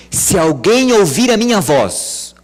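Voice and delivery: high, loud, slow calling voice; Jesus calling at the door. Acted. Jesus calling through the closed door, loud. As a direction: call out as if at the door.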